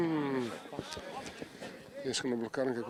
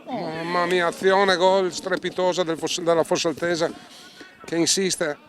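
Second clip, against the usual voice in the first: excited play-by-play, loud and high; breathless italian goal commentary; 7 semitones higher, 6 dB louder than usual. Acted. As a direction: call the attack live. Live play-by-play of attack.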